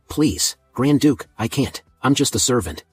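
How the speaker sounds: usual speaking voice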